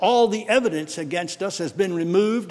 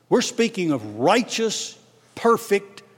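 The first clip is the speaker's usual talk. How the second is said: ordinary delivery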